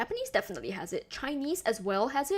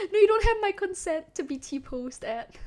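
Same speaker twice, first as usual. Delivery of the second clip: higher, louder, playful put-on voice; mock indignant refusal; 9 semitones higher, 6 dB louder than usual. To chat, refusing to be T-posed, in mock outrage. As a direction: fake outrage, half laughing. Teasing chat about T-posing.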